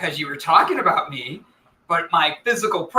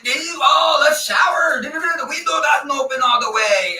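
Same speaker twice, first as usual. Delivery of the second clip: higher-pitched, hammed-up take on a friend's accent; imitating Lorenzo's accent; 6 semitones higher than usual. Host retelling a friend's shower complaint, imitated accent. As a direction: shout it in a mock version of his accent. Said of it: "I'm doing your accent for you".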